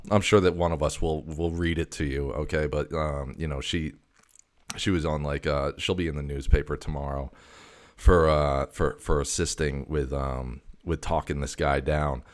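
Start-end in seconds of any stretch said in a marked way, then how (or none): none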